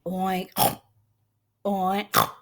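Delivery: oinks with a snorted ending; short, low-key piggy snorts; pig oinking. A narrator's reading. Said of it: "The pig says".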